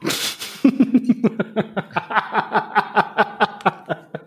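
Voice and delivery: long airy laughing fit; breathy laughter. Real, not acted. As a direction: laugh helplessly under your breath. Laughing fit after choking.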